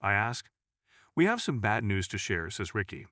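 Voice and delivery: plain reading voice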